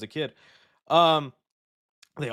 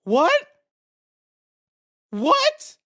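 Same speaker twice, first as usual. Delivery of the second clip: high, loud stunned what; genuinely floored by the news; shocked what; 13 semitones higher, 6 dB louder than usual. Real, not acted. Streamer hears the casting news, stunned high yelp.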